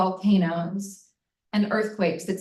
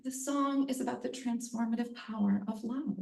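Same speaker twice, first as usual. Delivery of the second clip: soft, sincere quote; 2 semitones higher, 10 dB quieter than usual. Retold or imitated.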